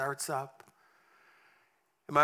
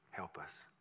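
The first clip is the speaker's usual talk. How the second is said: much softer, lower help us; hushed plea; 11 semitones lower, 14 dB quieter than usual. Acted. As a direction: breathe the plea. Prayer refrain.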